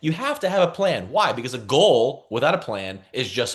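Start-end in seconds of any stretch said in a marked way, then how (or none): none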